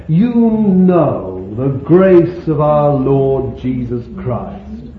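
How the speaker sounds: slow, loud verse reading; declaimed scripture. Acted. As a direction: declare the verse slowly, leaning on YOU.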